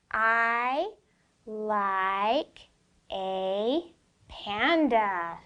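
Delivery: very slow reading, each word set apart. Shown as a demonstration. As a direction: stretch out every word for the child. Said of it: "Let's read it kinda slow".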